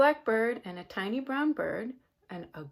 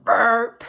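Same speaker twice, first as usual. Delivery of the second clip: loud mock burp; big burp; 13 dB louder than usual. Shown as a demonstration. Showing the children a big burp, loud mock burp. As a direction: let out a big fake burp. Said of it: "give yourself a big burp".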